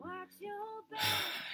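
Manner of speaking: long weary sigh. Real, not acted. Telling the shameful walk back, heavy sigh.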